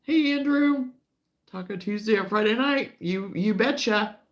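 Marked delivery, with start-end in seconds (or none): 0.0-0.9 greeting call. Real, not acted. Calling out a greeting.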